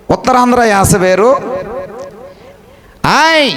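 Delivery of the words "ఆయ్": high, drawn-out Godavari interjection; Godavari accent. Shown as a demonstration. Preacher showing the congregation how Godavari people talk, Godavari accent. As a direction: call it out in Godavari dialect. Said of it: "ఇది గోదావరి యాస".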